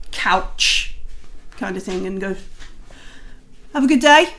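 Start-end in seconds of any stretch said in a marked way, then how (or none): 3.7-4.3 guard. Acted. Guards leave the visitors.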